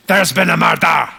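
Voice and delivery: broad, dramatic Scottish exclamation; Scottish accent. Shown as a demonstration. Comic demonstrates best phrase for it, broad Scottish accent. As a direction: announce it in a thick Scottish accent. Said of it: "the Scottish accent, probably the best phrase".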